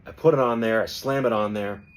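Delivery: ordinary talk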